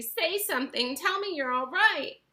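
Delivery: mother pleads for a sign he is safe, anxious. A narrator's reading. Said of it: "cried Missus T V".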